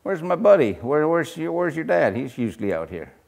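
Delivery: normal talking voice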